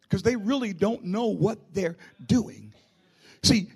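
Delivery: ordinary delivery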